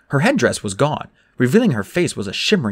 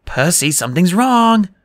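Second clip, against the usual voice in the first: higher, louder urgent warning; Annabeth insisting; 7 semitones higher, 5 dB louder than usual. A narrator's reading. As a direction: insist that something is wrong. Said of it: "Annabeth insisted".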